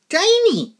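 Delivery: very high, small squeak of a word; squeaky tiny. Retold or imitated. Describing the little girl down the aisle, squeaky tiny voice. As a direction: squeak it to show how little she is. Stressing how small she is.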